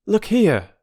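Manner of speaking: put-on a keen boy with an idea; young Peter, eager.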